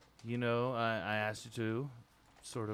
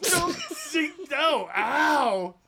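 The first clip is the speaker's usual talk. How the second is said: high, loud yelps of protest; pained yelling; 8 dB louder, much slower than usual. Acted. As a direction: yelp like you're being manhandled. Will yelping while being grabbed.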